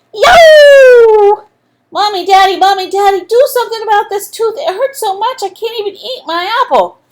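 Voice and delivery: put-on high, loud yelling child in pain; yelling boy. A narrator's reading. Andrew yelling to his mom about his tooth, loud and high. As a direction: shout it like a kid with a sore tooth. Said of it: "and yelled".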